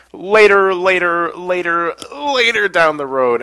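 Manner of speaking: drawn-out putting off. Real, not acted. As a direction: keep stretching out each "later".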